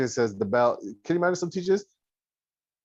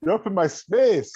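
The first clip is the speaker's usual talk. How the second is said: menacing teacher; 6 semitones higher, 4 dB louder than usual. Retold or imitated. Teacher tapping gun, threatening.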